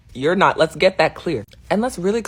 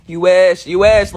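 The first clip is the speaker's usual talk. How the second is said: loud taunting shout; 12 dB louder, slower than usual. Real, not acted. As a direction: throw it at them, loud and teasing. Taunting the haters.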